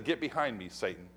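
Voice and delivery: quiet hushed rebuke to Peter; low stern rebuke. Retold or imitated. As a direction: drop the voice for a grave rebuke. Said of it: "and he said".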